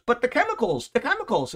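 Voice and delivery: put-on high, pleading French accent; mock French accent in a high fret; alarmed French accent. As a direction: play the Frenchman fretting over chemicals. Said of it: "because of the accent".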